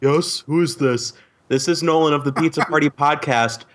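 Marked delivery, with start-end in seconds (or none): 0.0-1.1 slightly deeper mock cop voice; police officer voice. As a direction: answer like a bored police officer. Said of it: "talking like the police in a slightly deeper voice".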